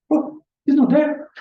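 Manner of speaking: high, surprised realisation; suddenly find it's not there, amazed; surprised discovery. Retold or imitated. Woman discovers depression not found.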